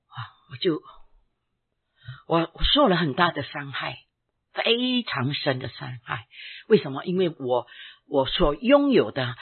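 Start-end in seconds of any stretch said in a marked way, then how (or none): none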